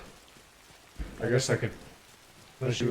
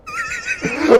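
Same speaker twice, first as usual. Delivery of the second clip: distorted laugh; 6 dB louder than usual. Real, not acted. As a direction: laugh garbled into a robotic squeal. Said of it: "I sound like a robot!".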